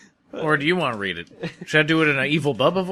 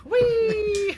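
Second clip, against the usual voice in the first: excited whee. Pretend. Players cheer sliding down.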